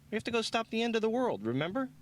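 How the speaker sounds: high, fast, exasperated. A narrator's reading. Nora scolds flirting Lloyd, high and exasperated. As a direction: rush it out, fed up.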